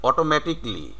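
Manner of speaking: "automatically" as oto-MATIK-lee; "automatically" ending in a quick "tik-li"; real "automatically". Shown as a demonstration. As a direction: say "automatically" with the "ca" dropped. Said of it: "real pronunciation is".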